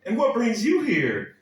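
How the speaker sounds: a stranger in Texas quizzes the wife about her accent, hammed-up and high; mock Texan asker. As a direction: bounce it up like an eager Texan stranger. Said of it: "a canned answer".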